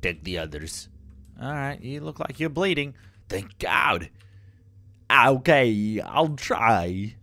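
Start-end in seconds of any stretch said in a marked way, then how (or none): 3.3-7.1 Christopher Walken. Retold or imitated. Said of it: "That's my Christopher Walken".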